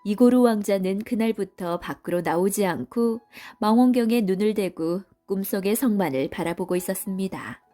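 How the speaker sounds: usual speaking voice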